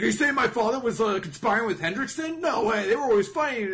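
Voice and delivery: high, loud, indignant protest; defensive outburst. Retold or imitated. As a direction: snap back defending his father. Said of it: "gets super defensive".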